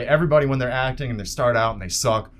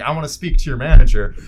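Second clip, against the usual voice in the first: entitled customer; 2 semitones higher, 11 dB louder than usual. Retold or imitated. Angry customer demanding manager.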